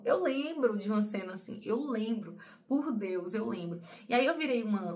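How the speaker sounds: ordinary talk, nothing marked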